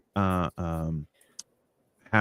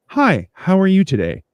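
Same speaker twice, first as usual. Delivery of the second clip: higher, cheery retail hello; upbeat mock small talk, pitched up; friendly greeting; 8 semitones higher, 4 dB louder than usual. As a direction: imitate a disarmingly friendly customer. Cheery greeting to counter angry face.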